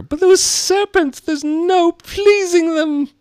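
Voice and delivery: slow, very high, loud complaint; put-on shrieking pigeon; exasperated pigeon. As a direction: drag out the grievance in a screechy bird voice. Pigeon character voice.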